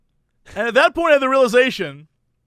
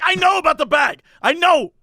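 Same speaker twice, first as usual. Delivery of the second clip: raised, exasperated mock shout; high, irritated retort; furious; 7 semitones higher, 4 dB louder than usual. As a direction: imitate an angry customer snapping back, pitched up. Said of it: "he's pissed now. He's, like, furious".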